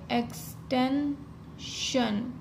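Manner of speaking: Extension drawn out very slowly while writing; slow dictation. Shown as a demonstration. Teacher writing the outline on the board, slowly. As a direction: spell out extension slowly, syllable by syllable. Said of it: "तो हम कैसे लिखेंगे?".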